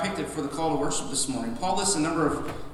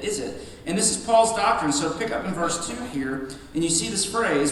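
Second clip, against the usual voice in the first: ordinary talk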